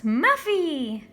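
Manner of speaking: high, loud delighted squeal; squeal of joy. A narrator's reading. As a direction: shriek the cat's name with joy. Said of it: "Judy squealed".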